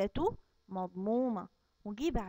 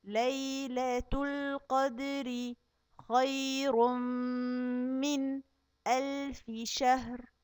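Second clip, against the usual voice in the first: slow drawn-out tajweed chant; Quran recitation; much slower than usual. Acted. Teacher reciting the new verses to the kids, slow chant.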